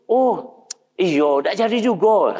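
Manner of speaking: high, slow mock apology; neighbour cancelling. Neighbour sending Imam Ahmad home again, high mock apology. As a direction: draw out a fake-sorry cancel.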